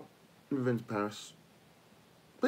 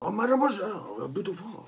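higher, hammed-up French flirting; mock French accent; 6 semitones higher than usual. Flirting in fake French for laughs, mock French accent. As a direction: play a cartoon French charmer. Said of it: "Every time I speak French".

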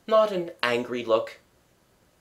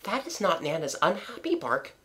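put-on soft, raised child's voice; knowing girl; 6 semitones higher, 6 dB quieter than usual. A narrator's reading. Wendy corrects John about the bark, hushed. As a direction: say it quietly, high and knowing.

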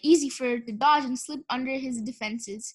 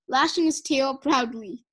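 usual speaking voice

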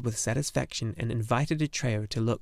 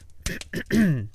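throat clearing; 5 semitones higher than usual. Acted. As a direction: clear your throat to be noticed.

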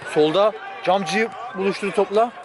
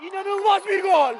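screaming football commentator. Real, not acted. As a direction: scream the goal call with joy. Second goal, screaming.

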